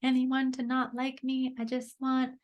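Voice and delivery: put-on childlike, pleading to be liked; little Amber's voice. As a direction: voice the scared little girl inside. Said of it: "who's just like".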